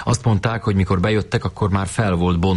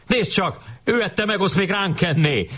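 high-pitched indignant yell without much volume; acted schoolboy outburst; shouted accusation; 13 semitones higher than usual. A narrator's reading. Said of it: "kiabált Tök Marci".

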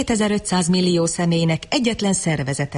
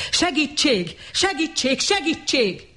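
much higher, panicked cries; screaming for help; 9 semitones higher, slower than usual. A narrator's reading. Bernard running to the police, screaming for help. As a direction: yell for help in terror. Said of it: "torka szakatából ordította".

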